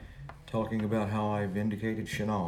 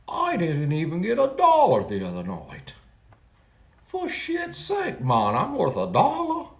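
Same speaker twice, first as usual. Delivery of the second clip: high-pitched, hammed-up Kentucky accent; bad Kentucky accent; 9 semitones higher, 3 dB louder than usual. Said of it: "that was bad Kentucky accent there".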